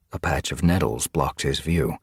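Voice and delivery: plain reading voice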